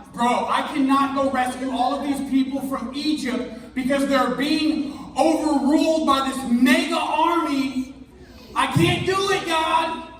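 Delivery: put-on high, pleading young voice; whiny Moses. Moses whining to God that he can't rescue the people. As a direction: beg out of the job like a whiny kid.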